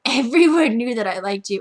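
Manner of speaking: loud, exasperated retelling; embarrassed outburst. Retold or imitated. Girl confronting the boy she liked, loud embarrassed outburst. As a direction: burst out with mortified emphasis.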